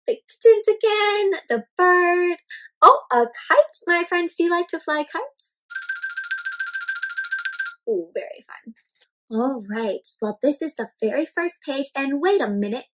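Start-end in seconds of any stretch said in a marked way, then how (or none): none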